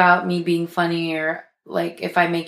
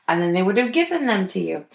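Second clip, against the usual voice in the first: ordinary talk, nothing marked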